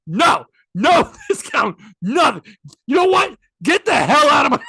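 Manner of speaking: loud, high-pitched angry shouting; forceful, punched-out yelling; retold furious eviction. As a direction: act out an owner throwing customers out. Retold husband throwing customers out.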